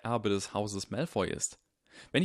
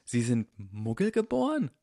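a touch of shocked disbelief; lightly startled question; 3 semitones higher, 3 dB louder than usual. Acted. Harry asking Dumbledore, mildly shocked question. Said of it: "sagte Harry schockiert".

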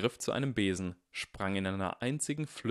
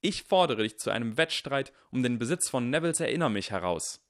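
loud, raised formal challenge; shouting; 5 semitones higher, 5 dB louder than usual. A narrator's reading. Harry challenges Goyle to a contest, shouting. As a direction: proclaim the challenge to all. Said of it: "schrie Harry".

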